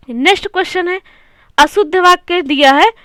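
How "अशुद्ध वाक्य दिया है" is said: ordinary delivery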